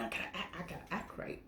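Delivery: hushed self-warning; whispered warning. Retold or imitated. Telling herself to behave in a foreign country, whispered. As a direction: whisper it to yourself.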